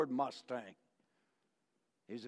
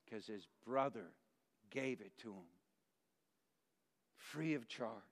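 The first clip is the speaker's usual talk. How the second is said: softer and lower, gentle; tender hush; 4 semitones lower, 9 dB quieter than usual. Acted. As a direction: tell your little brother gently. Boy tenderly telling crippled brother.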